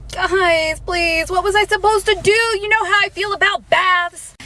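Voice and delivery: high whiny pleading voice; wide pitch range. Acted. Puppy James complains, high pitched.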